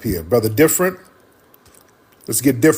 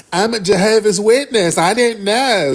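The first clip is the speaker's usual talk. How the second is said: high-pitched mock excuse; mocking imitation; 8 semitones higher than usual. Host mimicking a woman caller's excuse, mocking.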